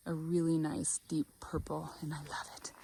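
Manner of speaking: a soft breathy whisper of delight; whispered admiration. Real, not acted. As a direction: hush it like a secret you adore. Admiring purple flowers on garden tour.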